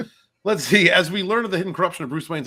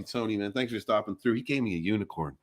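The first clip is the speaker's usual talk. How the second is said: usual speaking voice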